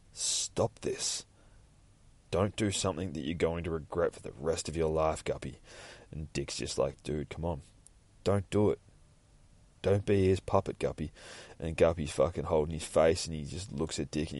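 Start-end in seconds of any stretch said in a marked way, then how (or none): 0.1-1.2 hushed urgent whisper; whispering hero begging; whispered plea. Acted. Nightwing pleads. 2.3-11.1 soft plea. Acted. Nightwing gentle plea.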